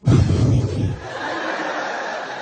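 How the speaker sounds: long forceful exasperated exhale; huge wordless huff of disapproval; silent breathing complaint. Shown as a demonstration. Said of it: "they just breathe out really hard".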